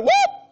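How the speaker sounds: high-pitched whoop of surprise; sudden whoop. Retold or imitated. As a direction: yelp as she pounces. Tambourine woman swoops in.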